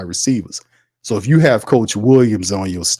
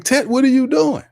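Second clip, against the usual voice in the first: mock disbelief; 13 semitones higher than usual.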